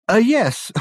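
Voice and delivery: high, caught-out yes; flustered Reg. Acted. Reg caught out about his new beard, flustered. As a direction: bluster a little.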